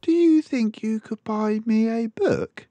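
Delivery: put-on high, sweet little girl pleading. A narrator's reading. Small daughter asking her father for a book, high and sweet. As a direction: ask like a hopeful small daughter.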